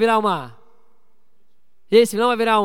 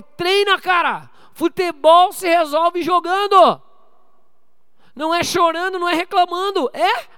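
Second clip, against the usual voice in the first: loud, high, slow coaching yell; coach shouting; 7 semitones higher, 6 dB louder, slower than usual. Retold or imitated. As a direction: push the kid hard, loud and slow. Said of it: "Eu falo". (o pai gritando com o filho para treinar, alto e lento)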